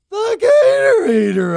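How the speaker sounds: high, loud crying scream; loud anguished scream. A narrator's reading. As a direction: scream it in disbelief. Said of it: "I screamed in reply".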